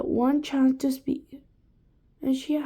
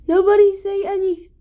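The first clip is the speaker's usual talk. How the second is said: urgent warning; 8 semitones higher, 8 dB louder, faster than usual. A narrator's reading. Child warns the class.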